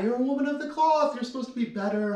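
high, fast scandalized priest scolding. Acted. Priest lecturing the nun, high and fast in scandal. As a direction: wag a finger as the shocked priest. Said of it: "the priest is like".

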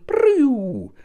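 toy sound effect. Pretend. Imitating toy's eject sound.

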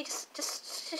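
breathy whisper, lost for words. Real, not acted. Vlogger gushing about the palette creator, whispered. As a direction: whisper it, too awed to finish.

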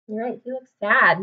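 ordinary delivery

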